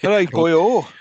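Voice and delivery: light, jokey Welsh lilt; mock Welsh accent. Podcaster mocking the actor's missing Welsh accent, mock Welsh. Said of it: "certainly not doing Welsh".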